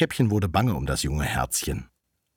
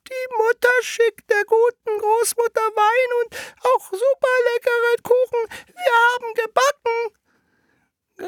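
extremely high, slow, loud falsetto; wolf's disguised voice; 6 dB louder, slower than usual. Acted. Wolf posing as Rotkäppchen at the door, disguised falsetto. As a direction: drag it out in a squeaky fake girl voice. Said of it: "mit verstellter Stimme".

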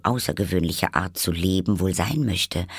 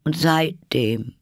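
slow, higher plaintive voice; sad; 10 semitones higher, 4 dB louder, slower than usual. A narrator's reading. Hatter tells of his quarrel with Time, mournfully. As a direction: lament it with drawn-out words. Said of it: "sprach der Hutmacher traurig weiter".

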